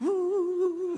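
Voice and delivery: high, loud drawn-out fake wail. Child crying harder once mother sympathises, loud mock wail. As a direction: turn up the imitated bawling. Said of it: "anaknya nangisnya tambah dikencang-kencangin".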